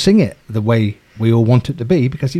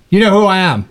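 pompous producer; 7 semitones higher, 4 dB louder than usual. Retold or imitated. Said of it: "He was a little bit brutal".

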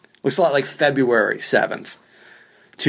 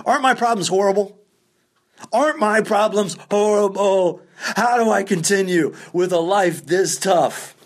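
higher-pitched mock woe, slowing into melodrama; mock self-pity; 6 semitones higher than usual. Comic moaning to the audience about rebooking a flight, mock self-pity. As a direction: wail about your tiny problems in mock despair.

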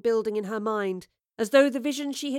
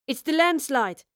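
raised-pitch, urgent call; announcing; 5 semitones higher, 5 dB louder than usual. A narrator's reading. Scavenger urging the gang on at the landslide, announcing. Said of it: "one of the scavengers announced".

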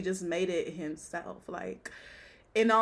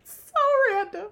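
very high, drawn-out words through laughter; helpless laughter; 4 dB louder, slower than usual. Real, not acted. Host reacts to stars' Jamaican infatuation, helpless laughing squeal. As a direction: squeal it slowly while cracking up. Cracking up over Jamaican obsession.

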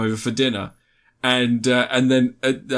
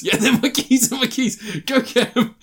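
high-pitched, over-eager retelling; excitable put-on voice; mock excitement; 11 semitones higher than usual. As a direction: act out giddy excitement, pitched well up. Acting excited man sent after keys.

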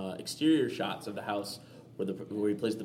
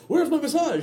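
high, louder mock indignant whine; Robin Williams impression; 6 dB louder than usual. Robin Williams back on set asking for his massage, high and mock-indignant. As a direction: demand it like a spoiled star. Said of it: "my Rob Williams impersonation".

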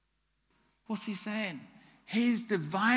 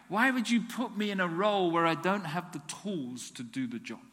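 ordinary talk, nothing marked

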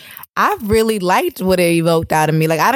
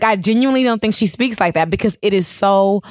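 ordinary talk

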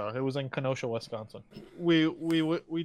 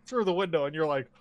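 ordinary talk

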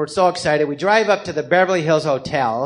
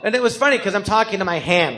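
ordinary talk, nothing marked

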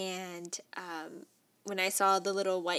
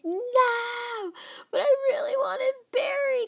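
much higher, tearful imitated sob; crying wail; 5 dB louder, slower than usual. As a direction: replay the heartbroken cry. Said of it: "I was in tears".

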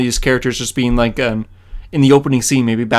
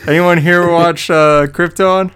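slow, louder, higher question; dramatic redo; 4 semitones higher, 5 dB louder, slower than usual. Shown as a demonstration. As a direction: stretch the question out and wait for the chirp. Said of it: "Let's, let's redo that".

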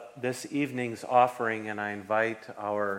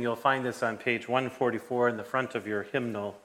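ordinary delivery

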